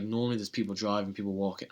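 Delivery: usual speaking voice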